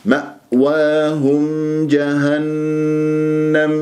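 slow chant, clear m in مأواهم, long hum in جهنم; clear meem. Shown as a demonstration. Teacher models clear meem in مأواهم, sustained recitation. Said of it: "ميم مظهرة".

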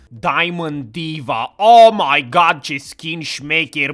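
slow, drawn-out skin showcase; mock showcase. Showing off the new skin in a slow mock ad voice. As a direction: draw out each word like an ad.

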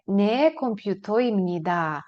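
Не комп'ютоємніда slow and falling; slow model answer. Shown as a demonstration. Teacher drills the answer slowly for students. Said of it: "ви скажете".

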